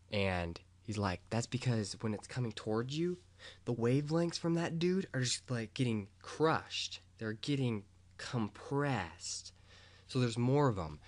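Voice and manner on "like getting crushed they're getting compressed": usual speaking voice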